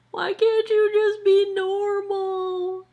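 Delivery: high, slow mock plea to her bangs; exasperated high whine at her bangs; mock scolding. Real, not acted. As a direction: scold your hair in a high, drawn-out voice.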